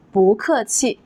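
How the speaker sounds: 不客气 with 不 rising in second tone. Shown as a demonstration. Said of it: "it will change to a second tone".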